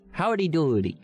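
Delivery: 1 silly voice. Pretend. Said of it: "I do a lot of voice acting".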